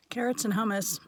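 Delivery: chewing while talking; talking through a mouthful; mouth full. Real, not acted. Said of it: "talking with food in my mouth".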